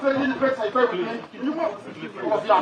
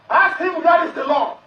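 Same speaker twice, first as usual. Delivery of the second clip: raised, higher shouted question; angry shout; 5 semitones higher than usual. Real, not acted. Defendant shouting at judge to show the law, angry. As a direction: demand an answer, shouting.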